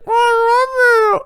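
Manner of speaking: very high, slow, whiny dog voice; talking dog. Retold or imitated. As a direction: squeak it like a dog. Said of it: "a dog say".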